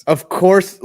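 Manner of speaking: loud, high, rising "Of course" in disbelief; exasperated outburst. Real, not acted. Complaining to co-host about the Eagles kicker, loud exasperation.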